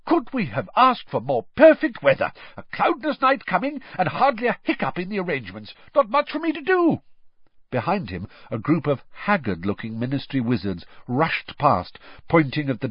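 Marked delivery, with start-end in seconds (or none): 0.1-7.0 high-pitched, hearty exclaiming; puffing, jovial character. A narrator's reading. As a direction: gush merrily in a high, strong voice. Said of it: "he puffed as he reached the campfire".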